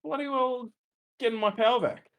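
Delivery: high-pitched indignant shout back; indignant retort. Acted. Tackled character answering the accusation, indignant high retort. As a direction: protest loudly, pitched well up.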